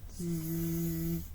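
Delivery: soft low snore; quiet drawn-out snore; snoring. Acted. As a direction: breathe out a sleepy snore.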